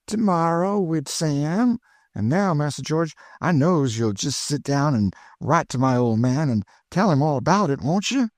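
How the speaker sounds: put-on high, eager old cook's voice; high cheerful Chloe, teasing her missus; Aunt Chloe. A narrator's reading.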